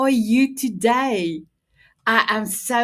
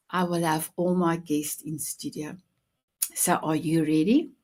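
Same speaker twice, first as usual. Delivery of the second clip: ordinary talk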